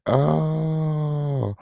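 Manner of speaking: long high held cry of oh; mock wail. Host reacting to the story, long mock wail. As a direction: wail it out dramatically.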